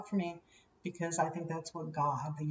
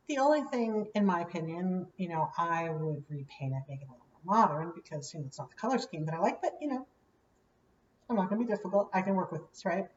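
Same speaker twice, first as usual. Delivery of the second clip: normal talking voice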